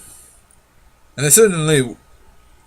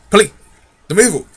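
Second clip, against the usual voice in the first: a mild shout, a little higher and louder; shouted warning; 6 semitones higher, 5 dB louder than usual. Acted. Digory shouts a warning at Polly. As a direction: warn her sharply. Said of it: "he shouted".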